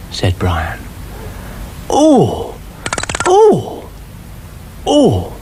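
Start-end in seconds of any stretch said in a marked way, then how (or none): 1.9-5.3 high rising oh sounds; a dithering snail who won't answer; Brian's voice. A narrator's reading. The snail dithers at Dougal's warning, high.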